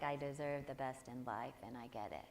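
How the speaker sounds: mock bored recital. Teacher imitating a half-hearted audience member, flat mock mumble. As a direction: parody people reciting it without caring. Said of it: "you know when you're standing up here and people do that".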